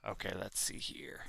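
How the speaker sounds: unvoiced whisper to himself; hushed aside while setting up. Real, not acted. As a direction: whisper it off mic. Assistant speaking off mic.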